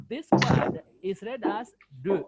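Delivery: de said with a clear French vowel; de, loud and slow; French de demo. Shown as a demonstration. Teacher drills de, loud and slow. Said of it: "not do".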